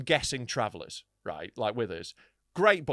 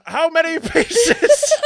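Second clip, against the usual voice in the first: loud, high, slow hammed-up question; mock spooky voice breaking into laughter; failed creepy actor; 11 semitones higher, 12 dB louder, much slower than usual. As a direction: draw it out through a laugh. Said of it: "he's trying to be creepy".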